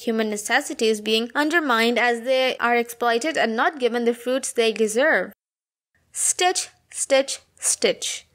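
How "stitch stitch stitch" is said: "Stitch", pause, "stitch", pause, "stitch"; pauses between repeats. Acted. Weary seamstress repeats stitch as she works, halting with pauses. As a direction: leave a clear gap after each "stitch". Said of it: "she pauses while repeating stitch".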